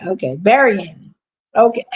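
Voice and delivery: usual speaking voice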